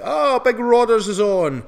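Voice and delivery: loud, high, drawn-out cheer; excited greeting. Real, not acted. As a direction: call out in delight to a regular. Greets a regular joining live chat.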